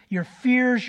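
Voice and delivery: ordinary delivery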